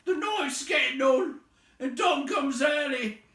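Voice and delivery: loud, high troll shout. A narrator's reading. Troll urging the others to get on, loud. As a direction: bellow for hurry.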